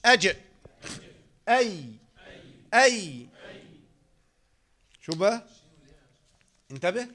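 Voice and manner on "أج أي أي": "أج", "أي" each said alone from the middle of the tongue; teacher sounding out "أج" and "أي"; letter articulation demo. Shown as a demonstration. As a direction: isolate "أج" and "أي" as tajweed sounds. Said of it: "الجيم والشين والياء".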